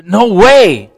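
loud, high acted disbelief; incredulous. Preacher playing Peter refusing Jesus, loudly incredulous. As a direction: balk as if you can't believe it.

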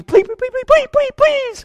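very high pleading whine; high frantic repeated pleas; desperate begging. Acted. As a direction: beg like throwing off all dignity. Begging on your knees.

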